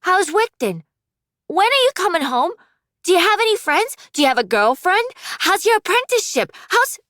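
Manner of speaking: put-on high, excited children firing questions; eager kid voices. Little siblings pestering their brother on the phone, eager high kid voices.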